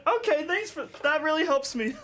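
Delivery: squeaky high voice, laughing through it; high sarcastic thanks. Retold or imitated. Recounting the stranger's comfort mid-turbulence, high ironic laughing thanks. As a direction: play it shrill and ironic, laughing. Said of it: "I went".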